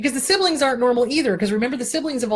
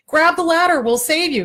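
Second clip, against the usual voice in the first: 1 helicopter rescuer calling; 7 semitones higher, 5 dB louder than usual. Acted. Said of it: "a ladder and says".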